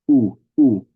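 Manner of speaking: a short pure u vowel; simple u. Shown as a demonstration. Teacher demos the simple u sound. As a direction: say U as a plain oo sound. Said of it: "just a simple U sound".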